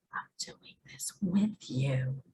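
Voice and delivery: hushed whisper, soft and intimate; whispered reassurance. Pretend. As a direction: breathe it like a secret comfort. God speaking softly to Lori.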